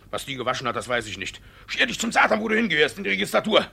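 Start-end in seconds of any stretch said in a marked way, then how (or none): 1.7-3.7 angry dismissal. Acted. Angrily sends maid away.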